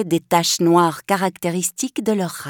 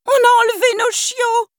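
Nanny crying out. Acted. Nanny on the pavement after the theft, panicked cry. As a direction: shout the alarm to the street. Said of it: "cria la pauvre Nanny".

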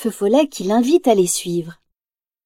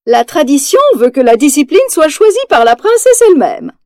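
regal, higher and louder proclamation; formal announcement; 5 semitones higher, 5 dB louder than usual. Acted. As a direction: announce it like a queen at court. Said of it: "annonce la reine".

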